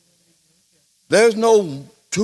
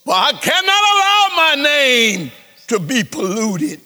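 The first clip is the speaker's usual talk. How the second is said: much higher, indignant divine voice with a laugh; God scolding, offended; God speaking; 7 semitones higher, 3 dB louder than usual. Acted.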